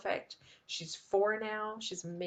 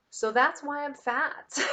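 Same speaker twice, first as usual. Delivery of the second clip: self-mocking joke; 8 semitones higher, 5 dB louder, slower than usual. Real, not acted.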